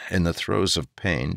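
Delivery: ordinary delivery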